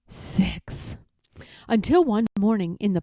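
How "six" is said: whispered aside. A narrator's reading. Narrator stresses the six years.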